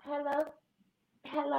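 lilting, soft hello; phone answer. Acted. Sister answering her brother's phone, soft sweet hello. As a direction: sing it into the receiver like a sweet girlfriend.

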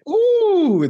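a high, loud, drawn-out ooh; impressed ooh. Real, not acted. Host reacting to guest's debut against Nolan Ryan, loud high ooh. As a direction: react like that's a big deal.

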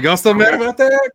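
high, very loud mock outrage; mocking incredulous shout. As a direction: holler it in teasing disbelief. Mocking British grudge over tea.

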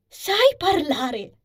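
high, loud, drawn-out cry of shock; almost terrified exclamation. A narrator's reading. Anisa to the animal that just spoke, shocked exclamation. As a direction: exclaim slowly in near-terrified disbelief. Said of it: "esclamò Anisa, quasi atterrita".

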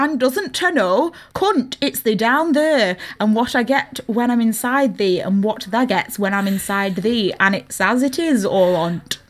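broad Derbyshire dialect, read aloud; Derbyshire accent. Shown as a demonstration. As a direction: put on a Derbyshire accent for the quote. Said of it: "Do it in Derbyshire".

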